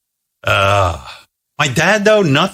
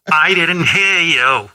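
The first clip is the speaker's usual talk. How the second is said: mom voice; 6 semitones higher, much slower than usual. Retold or imitated. Mother voiced in phone story.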